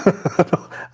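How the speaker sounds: high-pitched burst of laughter. Real, not acted. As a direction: crack up at what was heard. Reacting to the replayed clip.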